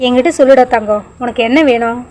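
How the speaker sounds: ordinary delivery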